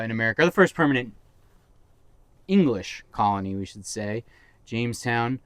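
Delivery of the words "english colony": normal talking voice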